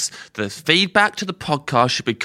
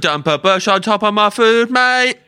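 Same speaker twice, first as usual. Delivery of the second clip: imitating Ed; 9 semitones higher, 6 dB louder than usual. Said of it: "repeats Ed's line".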